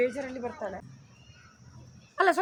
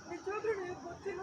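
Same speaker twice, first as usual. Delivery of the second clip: soft demonstrated tearfulness; tearful voice; 3 semitones higher, 10 dB quieter than usual. Shown as a demonstration. Director coaching how to cry, soft tearful demo. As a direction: say it softly, a touch of crying. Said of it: "ಅಳ್ಬೇಕು voice".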